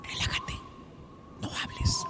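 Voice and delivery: hushed hypnotic whisper. Acted. Maestro whispering to the turqui during hypnosis. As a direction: breathe the words to put him under.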